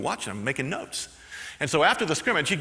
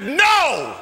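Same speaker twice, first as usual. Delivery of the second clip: loud, high flat refusal; coach yells no; 11 semitones higher, 8 dB louder than usual. Retold or imitated. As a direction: slam it shut. Coach shouts no.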